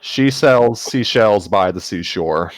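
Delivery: slow, carefully articulated tongue twister; clear enunciation. Shown as a demonstration. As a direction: spell out every sound slowly. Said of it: "make myself sound clear".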